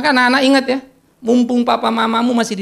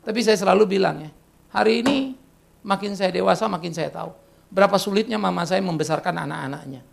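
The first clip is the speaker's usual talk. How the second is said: ordinary talk, nothing marked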